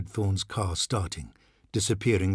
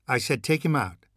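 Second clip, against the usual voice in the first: sharp insistence; 5 semitones higher than usual. Acted. Mother insisting to the nanny.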